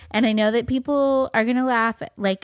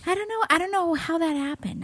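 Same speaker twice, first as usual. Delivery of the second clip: mock innocent; 7 semitones higher, 3 dB quieter than usual. Mock-innocent about ghost.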